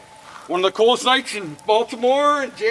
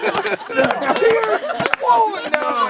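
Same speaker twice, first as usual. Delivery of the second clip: high, slow, laughing retelling; laughing through story; 10 semitones higher, slower than usual. Real, not acted. Recalling running lost through streets, laughing high. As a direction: laugh while telling it.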